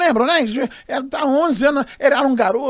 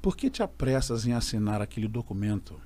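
lower, slow solemn warning; solemn divine voice; 8 semitones lower, 6 dB quieter than usual. Retold or imitated. A voice in his ear at church, low and solemn. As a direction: speak like a grave voice from above. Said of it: "e a voz assim pra mim".

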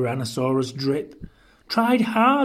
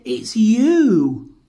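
high, loud, drawn-out reveal; hooting, higher and louder; amused reveal; 6 semitones higher, 6 dB louder than usual. A narrator's reading. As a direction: tell it with laughing glee. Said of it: "And they laughed and said".

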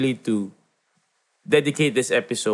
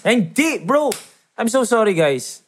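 sudden realization; 9 semitones higher than usual. Real, not acted. Catches his own mistake.

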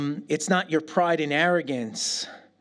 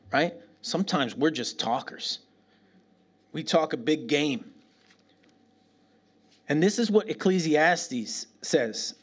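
ordinary talk, nothing marked

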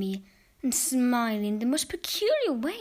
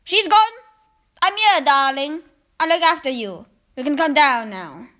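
reassuring call; 3 semitones higher, 8 dB louder than usual. Acted. Grandma reassures frightened boy.

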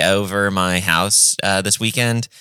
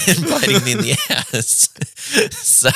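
high laughing words. Real, not acted. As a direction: crack up while saying it. Laughing through own punchline.

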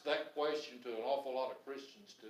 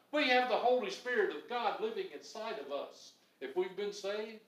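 usual speaking voice

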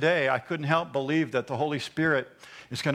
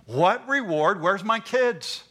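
Abram's pleading question; 5 semitones higher, 4 dB louder, slower than usual. Retold or imitated. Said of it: "Abe asked this question".

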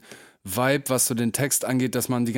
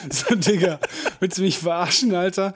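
hoch und laut, ungläubig empört; gespielt empört; 6 semitones higher than usual. Acted. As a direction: spiel den fassungslosen Kumpel. Empörte Reaktion auf Gehaltsvergleich. (gespielt empört)

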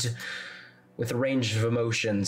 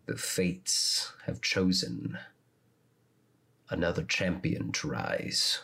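low, hushed commanding shadow voice; a dark patron giving orders; deep voice; 5 semitones lower, 3 dB quieter than usual. Acted. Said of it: "a deep voice respond".

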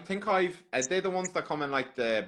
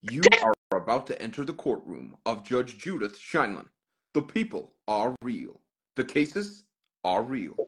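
slow, deliberate American TV-intro voice; American announcer accent with weighty pauses; 3 semitones lower than usual. Shown as a demonstration. Streamer performs the Judge Judy intro for fans, in his best American accent. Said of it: "intro to Judge Judy in my best American accent".

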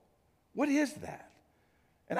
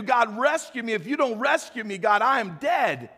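raised, higher pleading cry to God; a believer stuck in despair calling out to God; desperate plea; 6 semitones higher, 4 dB louder than usual. Retold or imitated. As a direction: beg God for rescue, loud and urgent. Desperate prayer voiced for congregation.

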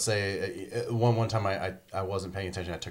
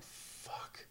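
whispered dismay; 19 dB quieter than usual. Retold or imitated. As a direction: curse under the breath, barely audible. Dismay when the enemies show up.

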